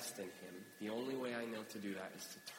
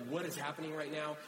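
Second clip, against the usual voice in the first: higher, louder alarmed question; retold panic, voice pitched up; freaking out; 6 semitones higher, 5 dB louder than usual. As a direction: act out a crowd freaking out. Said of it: "they freak out".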